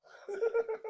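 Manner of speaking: high, staccato mock wail; acted outburst. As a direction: break into loud, stuttering mock sobs. Acting out his reaction to remembering.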